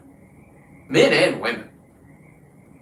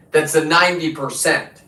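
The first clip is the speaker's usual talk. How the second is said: ordinary talk, nothing marked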